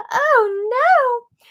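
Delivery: high, loud gasp of dismay; dismayed high-pitched oh no; shocked oh no. Real, not acted. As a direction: react in real alarm. Dismayed reaction to Vince.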